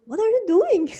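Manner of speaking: high-pitched mock bewilderment; baffled husband. Husband seeing the new home studio, imitated in bewilderment. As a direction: imitate someone taken aback, pitch shooting up.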